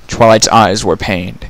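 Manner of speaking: plain reading voice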